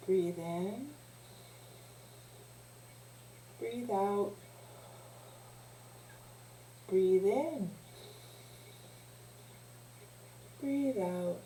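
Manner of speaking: soft, drawn-out breathing cues; hushed, unhurried breathing guide; guided breathing. Real, not acted. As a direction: lead a breath gently, with long pauses. Leads a breathing exercise.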